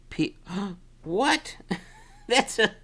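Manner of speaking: ordinary delivery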